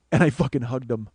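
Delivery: usual speaking voice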